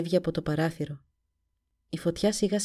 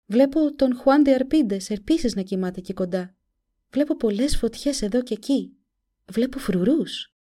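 put-on lightly raised girl's voice; a frightened young girl seeing visions; 6 semitones higher, 4 dB louder than usual. A narrator's reading. (φωνή κοριτσιού)

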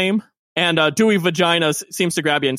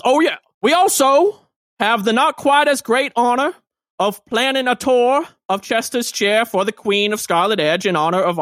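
higher, chirpy civic booster voice; pompous mayor; 3 semitones higher than usual. Acted. Mayor recruiting volunteers for party planning, chirpy and high. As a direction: sell the committee like a cheerful politician.

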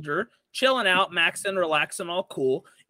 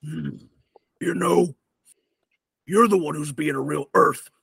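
low, grudging lizard folk barbarian; Joshwish the barbarian; 4 semitones lower than usual. Acted. Barbarian returning to deliver an insult, low growly voice. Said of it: "Joshua comes over and he goes".

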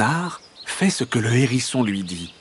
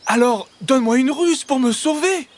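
high, urgent hedgehog voice; squeaky desperate request; pleading hedgehog; 3 dB louder than usual. A narrator's reading. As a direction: demand help in a panic, pitched high. Trapped hedgehog pleads for a trick.

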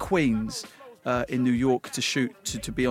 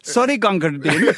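apology in a put-on Indian-style accent, louder; mock accent; 3 semitones higher, 5 dB louder than usual. Premier apologizing with a mocking accent. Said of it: "he did it in an accent".

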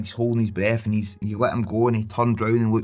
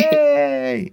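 put-on a high cheer for the kids; high, bright kids' class cheer. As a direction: cheer the jump into the water. Cheer in the pool game.

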